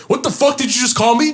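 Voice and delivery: high, outraged retold confrontation; making a scene. Host confronting guest over a slur, indignant. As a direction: demand an answer, pitch way up. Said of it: "I made a whole fucking scene".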